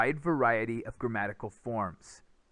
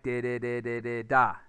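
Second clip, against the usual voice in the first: light di-di beats ending on a stressed da; rhythm syllables; 3 semitones lower, 4 dB louder than usual. Shown as a demonstration. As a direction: hum the sentence rhythm, hit the last beat. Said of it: "it's gonna sound like".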